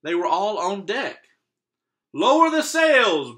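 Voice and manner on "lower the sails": high, loud, slow storm command; shouted order. Acted. Captain ordering the crew in a storm, shouting. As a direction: bellow it over the storm. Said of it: "Braid Beard shouted".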